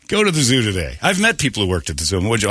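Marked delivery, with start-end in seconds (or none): none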